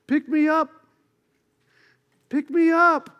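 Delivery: high, squeaky little frog calling out. Retold or imitated. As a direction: pipe up like a tiny frog from the lily pads.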